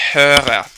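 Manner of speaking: "Höre" with the R rolled after the umlauted O. Shown as a demonstration. Teacher showing learners "Höre" with a rolled R. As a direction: roll the R in "Höre" on the tongue tip. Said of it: "I rolled the R after the umlauted O".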